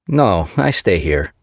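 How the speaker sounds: put-on slow, thick Swedish accent; heavy Swedish accent, few words. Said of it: "his Swedish accent thick".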